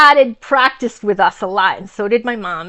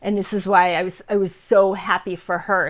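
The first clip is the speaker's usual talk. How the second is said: ordinary talk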